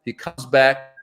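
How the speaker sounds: ordinary talk, nothing marked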